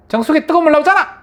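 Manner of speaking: fast, very high acted retort; offended wife snapping back. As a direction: fire it back loudly at the husband. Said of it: "아내는 기분 탁 상하는 거예요". (기분 상해서 빠르게 쏘아붙이는 말투)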